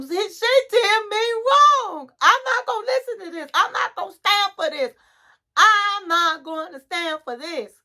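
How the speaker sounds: high-pitched acted protest; indignant outburst. Client refusing to stand for it, acted-out indignation. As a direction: imitate someone worked up and refusing to put up with it. Retold outburst in couples therapy.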